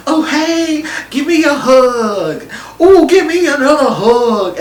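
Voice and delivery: high, drawn-out mock-perky greeting; imitated upbeat. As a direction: mimic her gushing, sing-song request for hugs. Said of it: "upbeat and about everything".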